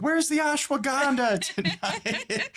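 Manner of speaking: high-pitched, drawn-out playful holler; mock yell. Husband jokingly yelling to his wife about the tea, mock yell. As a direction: call it out as a hammed-up yell to another room. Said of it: "like, yelling to my wife".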